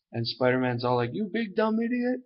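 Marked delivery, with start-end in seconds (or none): 1.1-2.2 high-pitched, exasperated mock insult; mock scolding. Spider-Man telling off the Juggernaut, high mock scolding. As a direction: scold in a much higher voice.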